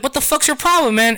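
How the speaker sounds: high-pitched, mock-indignant confrontation; imitating an offended friend; retold indignant challenge.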